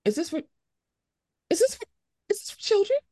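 high, repeated incredulous question; stunned, climbing up in pitch; baffled disbelief. Retold or imitated. Adult viewer questioning a kids' movie, high baffled.

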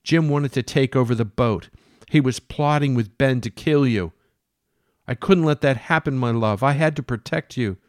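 a mad woman serving the captain breakfast; Jane. Acted. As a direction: play her as doting and unhinged. Jane voiced as a character.

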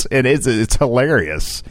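normal talking voice

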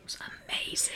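soft whispered aside. Real, not acted. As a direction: whisper it quietly. Whispered comment.

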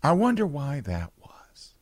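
breathy whispered irony, slowly; conspiratorial sarcastic whisper. Real, not acted. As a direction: whisper it knowingly, slow. Sarcastic rhetorical aside.

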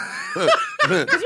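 high burst of laughter; laughing. Real, not acted. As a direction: break into a real laugh. Bursting out laughing mid-sentence.

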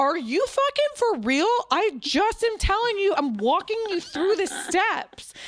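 high-pitched mock outrage; comic exasperation. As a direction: play up exasperation for laughs, pitch way up. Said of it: "a funny way".

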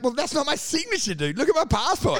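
laughing mock protest to customs officers; mock indignation. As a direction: play the offended traveller. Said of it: "you're like".

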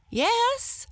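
very high squeaky yes; small hare. A narrator's reading. Hare answers the lion, high squeak.

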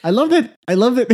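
high delighted gush; excited. Real, not acted. Excited praise.

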